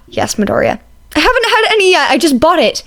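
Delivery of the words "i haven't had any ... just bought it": indignant yell. Acted. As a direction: shout after the friend who took your drink. Izuku protests.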